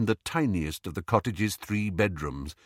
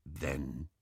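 very soft, low goblin; 6 semitones lower, 12 dB quieter than usual. A narrator's reading. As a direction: drop to a low, quiet goblin voice. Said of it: "said the goblin softly".

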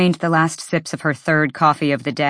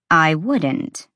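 usual speaking voice

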